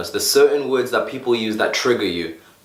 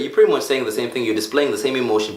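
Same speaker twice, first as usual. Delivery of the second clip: usual speaking voice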